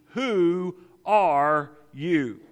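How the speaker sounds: very slow and loud, each word weighted; a pivotal question, hammered out word by word; pointed, emphatic question. Acted. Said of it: "It was a very pointed, pivotal question".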